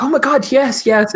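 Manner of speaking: high, loud fangirl squeal; over-the-top starstruck yes; ecstatic fan. Acted. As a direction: squeal with excitement at the offer. Fan offered an autograph.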